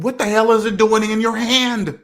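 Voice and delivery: high-pitched mock disbelief; incredulous outburst. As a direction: pitch it up, baffled and indignant. Acts out his incredulous thought.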